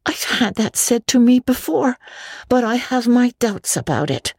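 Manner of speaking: put-on high girlish doubt; skeptical Anne. Anne doubts Marilla's proverb, high skeptical girl. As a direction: raise a young girl's doubt. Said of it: "remarked skeptical Anne".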